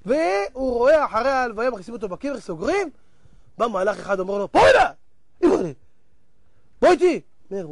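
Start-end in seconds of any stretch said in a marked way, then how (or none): 4.5-7.2 loud, drawn-out order to come; acted commanding call; stern summons. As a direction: summon him sharply, acting the angel. Angel summons the dead man.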